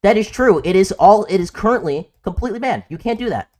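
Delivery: loud, high, heated insistence. Real, not acted. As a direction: push back hard and loud. Heated debate, raised voice.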